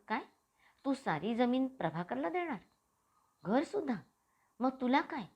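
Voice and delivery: a mother caught off guard by the news; startled mother. A narrator's reading. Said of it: "पार्वतीबाईंनी चमकून विचारलं".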